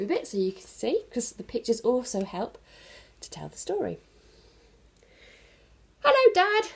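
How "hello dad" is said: put-on much higher, louder little boy voice; chirpy young Bernard greeting; child's voice. Bernard calls out hello to his busy dad.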